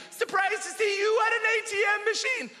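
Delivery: high shouted jeer; mock shout. Cyclist shouts at comedian passing by, high mock yell. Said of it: "he shouted at me".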